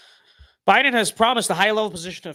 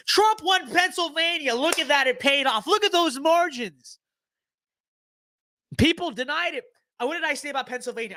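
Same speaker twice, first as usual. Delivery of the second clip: high, gleeful victory crowing; triumphant gloating; 9 semitones higher than usual. Real, not acted. Player gloating to viewers after winning Pennsylvania, high and triumphant. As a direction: crow over the win, pitch way up.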